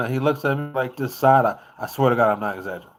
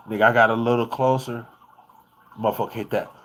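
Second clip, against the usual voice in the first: ordinary delivery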